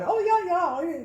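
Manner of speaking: high, quick imitation of an agreeing friend; lively mock agreement; eager chiming in. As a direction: chime in high and loud, mimicking her.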